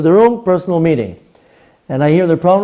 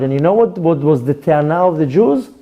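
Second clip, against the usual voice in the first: usual speaking voice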